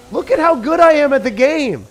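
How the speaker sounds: loud, high, sarcastic showing off; mock bragging. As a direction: brag like a smug pro, dripping irony. Sarcastic bragging about easy deck.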